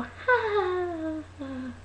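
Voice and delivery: soft, high fake laugh trailing off. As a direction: top it off with a quiet, insincere titter. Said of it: "you just fake laughed".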